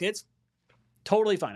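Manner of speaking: ordinary delivery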